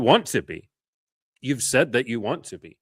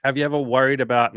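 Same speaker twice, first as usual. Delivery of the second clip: higher, louder announcing read; reading a chat question in a higher, louder voice; reading a question aloud; 6 semitones higher, 6 dB louder than usual. Retold or imitated. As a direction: read out the viewer's question brightly. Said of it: "K two one five says".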